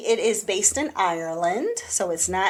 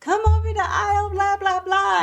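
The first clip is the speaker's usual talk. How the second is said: store announcer; 11 semitones higher than usual. Retold or imitated. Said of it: "they make an announcement".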